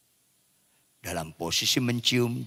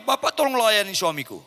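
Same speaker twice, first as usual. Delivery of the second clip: high, louder imitation of an anxious wife; wife's appeal; 10 semitones higher, 5 dB louder than usual. Wife asking pastor to help her husband, high imitated appeal. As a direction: plead to the pastor in a raised voice.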